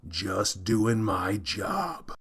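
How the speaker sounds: a slow, breathy whisper of the catchphrase; hushed, slow repeat of the line. Acted. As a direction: murmur it low and slow to himself.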